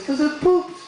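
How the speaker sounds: put-on high little-boy voice; young son. Son explaining why he's laughing, high kid voice. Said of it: "And he goes".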